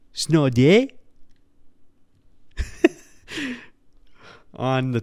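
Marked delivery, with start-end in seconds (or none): 0.1-0.9 put-on louder, higher Toronto-accent Snow Day with a rise. Podcaster shows off his Toronto snow day accent. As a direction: belt the catchphrase like the Toronto character. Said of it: "I can do the snow day".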